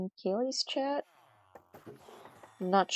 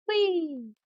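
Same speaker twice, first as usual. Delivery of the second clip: high squealing "whee"; playful whee; 12 semitones higher, 3 dB louder than usual. Real, not acted. Streamer dropping down in game, gleeful high whee. Playful cry while falling.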